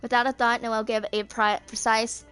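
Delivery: ordinary delivery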